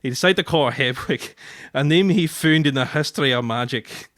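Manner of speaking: broad put-on Scots reading; Scottish accent. Shown as a demonstration. Husband reading a Scots book excerpt to his wife, Scottish accent. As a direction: read aloud in thick Scottish. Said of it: "putting on the Scottish accent".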